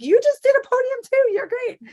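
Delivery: very high, excited hype-up; re-enacted high-pitched cheerleading for a friend; gushing reply. Acts out own gushing reply.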